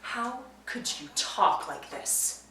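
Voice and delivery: hushed reproach. Acted. Wife appalled at the guests' joking, hushed reproach. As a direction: drop to a wounded near-murmur, word by word.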